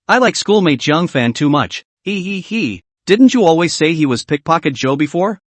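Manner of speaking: plain reading voice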